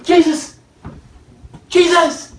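frightened disciples calling out; panicked call. Acted. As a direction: cry out to wake him. Disciples calling to wake Jesus.

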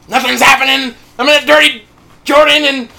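loud, high retold shouting complaint; offended outburst. Preacher imitates Naaman after his third dip, loud offended outburst. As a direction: protest at full volume, acting out the indignation. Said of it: "He's so offended".

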